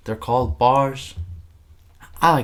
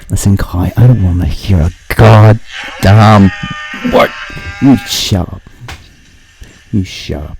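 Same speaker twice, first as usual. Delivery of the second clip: louder, mock stern scolding; mock angry telling-off; 11 dB louder than usual. Host playfully telling co-host Kai to shut up, mock angry. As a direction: snap at him playfully.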